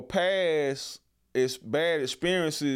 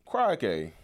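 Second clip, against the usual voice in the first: mock English accent; 5 semitones lower than usual. Shown as a demonstration. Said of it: "No one says crikey anymore".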